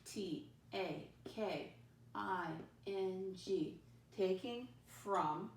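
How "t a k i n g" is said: slow letter by letter spelling. Shown as a demonstration. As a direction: say each letter slowly. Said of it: "if I don't know how to spell".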